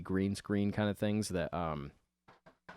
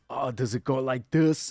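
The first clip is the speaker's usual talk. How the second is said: louder, put-on goofy dad voice; mock goofy dad; 5 semitones higher, 6 dB louder than usual. Dad clowning with his son's belt, goofy put-on voice. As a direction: ask it like a clueless dad clowning. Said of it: "He goes".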